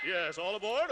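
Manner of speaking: ordinary talk, nothing marked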